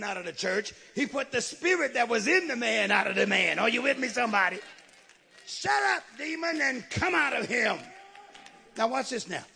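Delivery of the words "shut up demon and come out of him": high-pitched opening, forceful order; commanding shout. Retold or imitated. Jesus orders the demon out of him, commanding shout.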